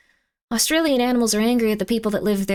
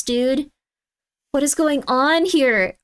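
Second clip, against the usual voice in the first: ordinary talk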